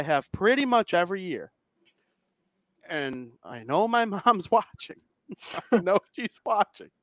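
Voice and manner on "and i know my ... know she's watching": laughing. Real, not acted. Laughs about mom watching.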